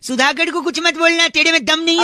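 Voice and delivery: high-pitched, worked-up protest; mock-indignant taunt. Prank caller taunting the cursing callee, mock indignant. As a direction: goad him in put-on outrage.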